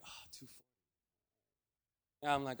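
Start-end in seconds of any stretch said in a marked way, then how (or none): 0.1-0.7 breathless unvoiced whisper of disbelief; hushed, stunned aside; shocked whisper. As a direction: barely breathe the number out. Stunned reaction to the sum.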